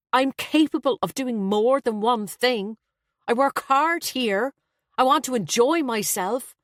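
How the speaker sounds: son's sharp self-justification. A narrator's reading. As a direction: stand up to the mother sharply. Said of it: "Her son's tone was sharper".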